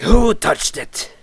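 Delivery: high, slow raspy yell; Zane's deranged raspy shout. Acted. Zane demanding who touched his notes, deranged raspy shout. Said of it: "deranged voice, but I can't".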